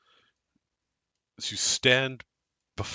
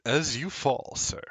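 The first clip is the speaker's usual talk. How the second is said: scene narration; 4 semitones lower, 4 dB quieter than usual. Acted. Game master narrates the scene.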